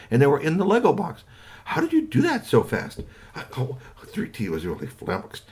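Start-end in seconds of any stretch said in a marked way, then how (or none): 1.6-3.0 very high astonished question; amazed boy. Acted. Boy asking the robot, astonished and very high. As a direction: gape at the robot in wonder.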